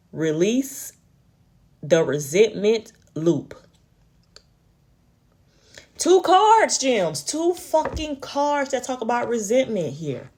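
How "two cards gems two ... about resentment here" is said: exasperated. Real, not acted. Exasperated at repeated card.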